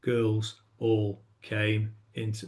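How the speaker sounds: slow, low and soft, reading it flat; dull bedtime monotone. Shown as a demonstration. Teacher showing how not to read, slow and flat. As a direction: drone it out like a sleepy bedtime read. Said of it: "if you tell it like that".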